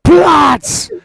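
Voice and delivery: loud, high shouted German command; yelled command. Acted. Wife to a running police dog, full yelled command. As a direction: yell it to stop a charging dog. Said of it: "So she yells the word".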